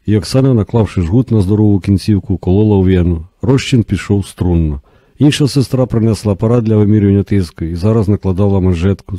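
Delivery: ordinary delivery, nothing marked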